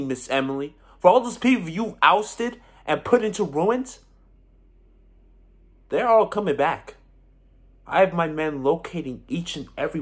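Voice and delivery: impassioned, high and loud reckoning; heated proclamation of payback, gradually coming down; vengeful declaration. Acted. Pronouncing enemy's doom.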